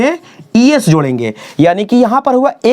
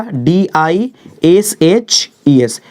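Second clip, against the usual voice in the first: slow spelling; 3 semitones lower, much slower than usual. Shown as a demonstration. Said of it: "spelling out dishes".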